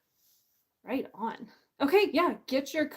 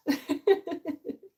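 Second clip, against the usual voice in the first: real laugh, higher than the speaking voice; 7 semitones higher, 4 dB louder than usual. Real, not acted. Author laughing at her own failed acting, real laugh. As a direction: laugh at your own silliness.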